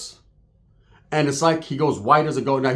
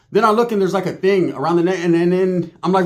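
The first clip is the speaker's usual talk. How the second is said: ordinary talk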